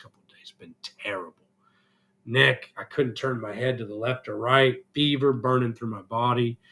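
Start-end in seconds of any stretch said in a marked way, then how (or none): 0.0-1.4 breathless whisper, no voice at all. Real, not acted. Telling viewers how sick he's been, in a whisper. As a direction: let it fall to a whisper. Sick, describing feeling awful.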